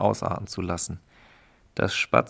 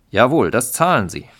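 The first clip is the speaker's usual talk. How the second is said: louder yes-men chorus; eager agreement; 3 semitones higher, 7 dB louder than usual. Acted. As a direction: back the boss up loudly. Underlings loudly agree with chief.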